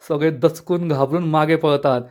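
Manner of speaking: usual speaking voice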